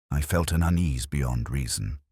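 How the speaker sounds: plain reading voice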